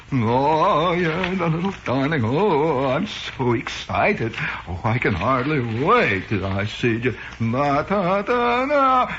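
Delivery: gushing Westchester-jaw dandy, swooping up high; affected Westchester singsong, giddy with anticipation; Westchester lockjaw, Harvard accent. Acted. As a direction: coo excitedly in a mock Harvard accent. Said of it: "He's got that swinging Westchester jaw".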